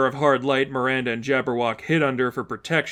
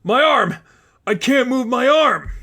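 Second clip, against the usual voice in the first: much higher, louder panicked yelp; a giant suddenly can't move his arm; panicked cry; 10 semitones higher, 5 dB louder than usual. Acted.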